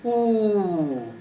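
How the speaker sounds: oo held long and steady; a sustained oo, as in boot; deep long sound. Shown as a demonstration. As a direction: draw oo out as one long vowel. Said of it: "this is a deep sound".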